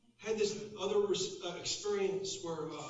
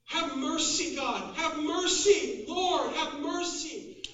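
loud, high desperate plea; imitated prayer shouted in desperation; voice rising above another; 9 semitones higher, 8 dB louder, slower than usual. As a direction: cry out over the other voice. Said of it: "my voice would rise above his".

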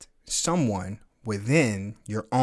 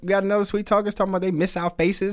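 higher, quick and eager; excited chat reading; 8 semitones higher than usual. Real, not acted. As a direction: read the chat out with delight. Host reads chat excitedly.

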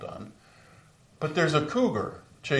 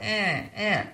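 high little whimpering eh eh of a cub; frightened bear cub cries; 8 semitones higher than usual. Retold or imitated. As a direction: squeak like a scared cub, much higher. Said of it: "this little bear going".

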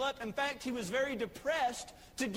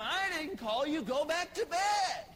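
more grumpy and upset; 5 semitones higher than usual. Acted. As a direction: bark it like an annoyed old priest. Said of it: "more grumpy, more upset".

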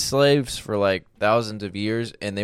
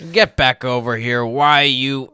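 put-on old-timey angry man; 3 semitones higher, 5 dB louder than usual. Mock old-timey angry threat.

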